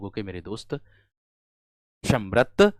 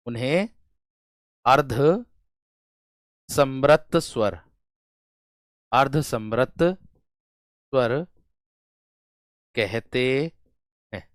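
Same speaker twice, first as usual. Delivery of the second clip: अर्धसमृत स्वर drawn out slowly, syllable by syllable; teacher dictating अर्धसमृत स्वर very slowly; slow dictation; much slower than usual. Shown as a demonstration. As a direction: spell out अर्धसमृत स्वर slowly so students can write it. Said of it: "उन्हें अर्धसमृत स्वर कहते हैं".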